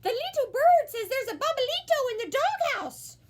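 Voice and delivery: high, loud excited kitten; a high kitten voice passing on news; excited kitty. A narrator's reading. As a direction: blurt out the big news. Kitty reports bird's message.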